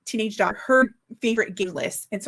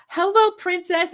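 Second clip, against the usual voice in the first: higher, sing-song greeting imitating the donut lady; sweet shop lady; 9 semitones higher, 3 dB louder than usual. Donut shop lady greeting her customer, sing-song. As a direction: mimic a doting clerk greeting a customer. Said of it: "the lady was hilarious".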